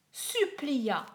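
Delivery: ordinary delivery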